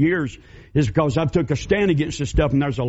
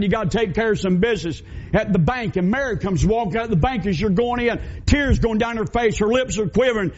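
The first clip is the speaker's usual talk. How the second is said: ordinary talk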